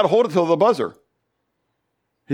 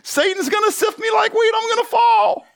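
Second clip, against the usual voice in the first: much higher, squealing mock excitement; mock excited Peter; 3 dB louder than usual. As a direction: play Peter as an over-the-top giddy fan. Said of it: "You're Peter".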